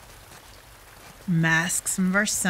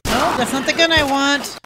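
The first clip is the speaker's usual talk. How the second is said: high loud angry yell; frustrated shout; 7 semitones higher, 10 dB louder than usual. Real, not acted. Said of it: "Sorry, I'm panicking".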